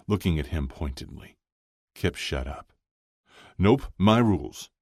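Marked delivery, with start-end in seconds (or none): 3.6-4.7 high, loud veto; firm overruling. Acted. Chuck insists on his rules, loud and firm. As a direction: overrule them heartily.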